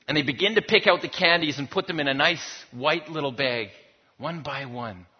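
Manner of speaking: ordinary delivery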